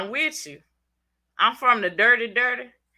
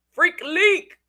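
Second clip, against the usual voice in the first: playful call-out; 7 semitones higher, 5 dB louder than usual. Acted. Calls out viewer's nickname.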